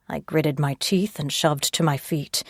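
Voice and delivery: ordinary delivery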